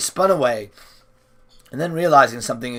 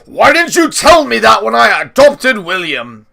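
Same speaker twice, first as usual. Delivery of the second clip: loud, higher cry of disbelief; shocked outburst; 7 semitones higher, 8 dB louder than usual. A narrator's reading. Grandpa shocked at Giles, loud outburst. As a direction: demand it in shock.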